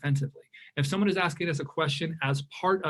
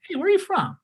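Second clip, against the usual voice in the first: friendly question; 4 dB louder than usual. Retold or imitated.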